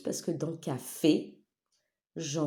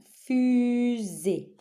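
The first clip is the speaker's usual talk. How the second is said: "Fusée" as two long raised syllables; 5 semitones higher, 4 dB louder than usual. Shown as a demonstration. Teacher helping pupils find the right syllable, drawn out. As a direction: hold FU, then place SÉE. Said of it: "dans la bonne syllabe".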